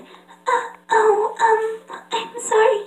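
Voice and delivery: high, soft, hesitant apology; timid pony voice. Acted. Shy pony apologizing for being in the way, timid. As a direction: shrink into a tiny high voice. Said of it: "It makes a splutter shake".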